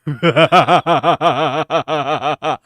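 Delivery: loud dramatic supervillain cackle; evil chuckle. Acted. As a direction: cackle like a movie villain. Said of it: "most dramatic supervillain laugh".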